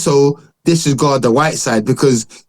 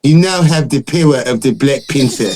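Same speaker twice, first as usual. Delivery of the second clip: mock African film accent, heavily bent vowels; exaggerated, mangled African accent impression; Forrest Whitaker. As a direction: put on a bad African movie accent. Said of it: "he's like this".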